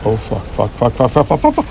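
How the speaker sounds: panicked cursing rising to a high yelp; swearing in rising panic, ending in a shriek; being chased. Real, not acted. Monster chasing in game.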